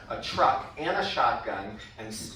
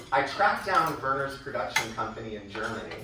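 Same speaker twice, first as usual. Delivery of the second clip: normal talking voice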